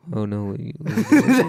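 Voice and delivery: drowsy, laughing through it; sleepy voice. Retold or imitated. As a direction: mumble like half asleep. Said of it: "this sleepy voice comes out".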